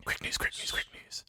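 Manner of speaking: breathy whispered jingle; whispering. Pretend. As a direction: whisper the words in rhythm.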